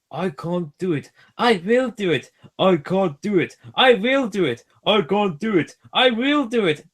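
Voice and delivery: puffing together. A narrator's reading. Both engines struggling up the hill, chugging and building. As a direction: alternate like two engines straining. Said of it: "they puffed together".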